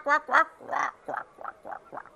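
quacking that fades to a whisper; imitating a duck. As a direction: quack along, then fade out.

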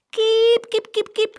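loud, very high hen call; calling chickens. A narrator's reading. Boy calling a funny little hen, high calling. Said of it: "riep hij".

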